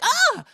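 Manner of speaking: high loud short shriek; mock startled scream. J-Lo scared by the cat, high hammed-up shriek. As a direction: jump with a yelp.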